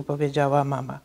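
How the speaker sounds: ordinary delivery, nothing marked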